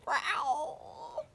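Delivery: high squeaky tiny growl; a caterpillar trying to roar; squeaky little growl. Acted. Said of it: "very squeaky little growl".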